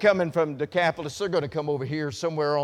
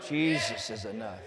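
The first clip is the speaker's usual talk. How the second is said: softer and lower, almost to himself; soft echo; 5 semitones lower, 7 dB quieter than usual. Acted. Preacher to congregation, repeating the line softly. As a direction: let it fall quietly after the shout.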